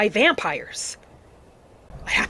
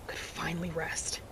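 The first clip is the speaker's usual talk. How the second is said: breathy whispered vow trailing off; a private whispered promise to himself; 4 semitones lower, 14 dB quieter than usual. Acted. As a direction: let the last words fall to a whisper. Vow ending in hushed whisper.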